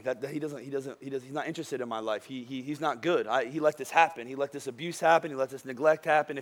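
a hint of a broken person questioning God; hurting believer. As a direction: speak their bitter doubt quietly, like an old hurt. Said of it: "Some of you think that".

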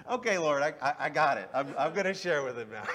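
laughing surrender. Retold or imitated. Laughing at the Lord's nudge.

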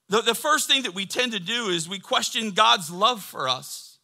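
loud, high, driving sermon; impassioned pulpit crescendo; full-force preaching. Real, not acted. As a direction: shout assurance to the whole congregation.

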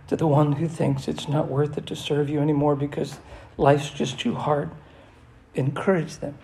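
steady, earnest prayer voice. Acted. Pastor praying over the congregation in an earnest voice.

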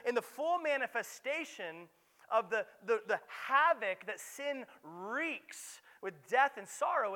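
loud, high preaching build; impassioned sermon voice; full-force preaching. Acted. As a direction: drive it home from the pulpit.

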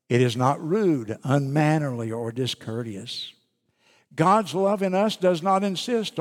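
measured, solemn Bible reading; scripture reading. Acted. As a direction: read the verses with weight. Reads scripture aloud.

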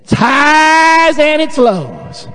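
hammed-up slow Southern drawl, pitched high; dumb Southern saying. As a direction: stretch it out as a mock-stupid Southerner. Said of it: "it sounds even more dumb when we say it".

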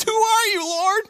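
a scared Scooby-Doo Shaggy. Retold or imitated. Saul asking the voice, as a frightened Shaggy. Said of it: "a Shaggy voice from Scooby-Doo".

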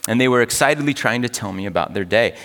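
ordinary delivery

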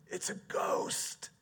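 disciples cry out at a figure on the sea, fearful. Acted. Act out a hushed, frightened outcry. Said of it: "They cried out in fear".

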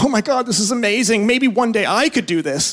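high, giddy starstruck gasp of wonder; starstruck awe. Retold or imitated. Out-of-shape fan at Old Trafford, gushing in high starstruck awe. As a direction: gush like a fan standing in the famous tunnel. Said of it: "and I'm like".

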